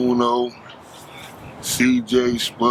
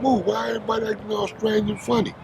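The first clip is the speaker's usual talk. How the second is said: retold own question; 10 semitones higher than usual. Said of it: "I'm like".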